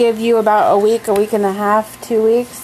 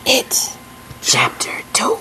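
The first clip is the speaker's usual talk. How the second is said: spooky whisper; 3 semitones higher, 4 dB quieter than usual. Pretend.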